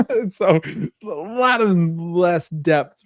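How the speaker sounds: high giggly laugh into slow words. Real, not acted. Host cracking up at the donkey and Shrek bit, laughing. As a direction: still laughing as you talk.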